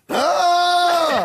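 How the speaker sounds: high sustained shouted note; loud high cry. Acted. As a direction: belt out a high wail.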